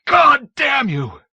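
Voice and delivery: slow, very high, full-voiced howl of rage; grieving man's shouted curse; anguished scream. A narrator's reading. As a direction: bellow it at Death itself. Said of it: "he screamed".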